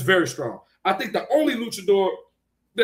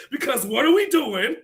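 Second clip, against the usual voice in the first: usual speaking voice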